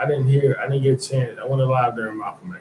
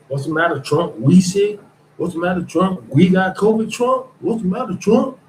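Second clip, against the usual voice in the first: higher and higher whiny fawning, rising questions; mock fawning servant; 7 semitones higher, 3 dB louder than usual. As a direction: climb ever higher in hammed-up fawning concern. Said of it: "That's them".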